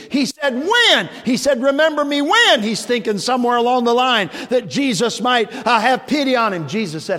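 loud, high preaching, full force; fiery preaching. Acted. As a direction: preach it out to the congregation.

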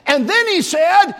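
loud, high preaching, full force; fiery preaching. Acted. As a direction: preach it out to the congregation.

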